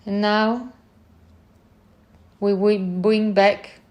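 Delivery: normal talking voice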